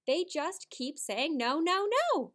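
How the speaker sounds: emotional exclamation. Shown as a demonstration. Teacher modelling an exclamation point with strong feeling, slow and loud. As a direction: let the no, no ring out with feeling. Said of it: "to show a lot of emotion with that sentence".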